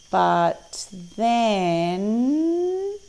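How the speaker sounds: drawn-out words gliding upward; storyteller's rising cliffhanger; suspenseful build-up. A narrator's reading. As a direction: stretch it out and let the pitch climb. Suspense before the crocodile appears.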